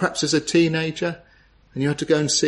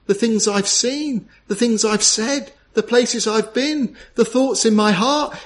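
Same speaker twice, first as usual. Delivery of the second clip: penitent's confession; 6 semitones higher than usual. Acted. Backslider confessing sins to the Lord, pleading confession. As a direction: confess it with mounting anguish, voice lifted. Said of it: "tell him all about it and say".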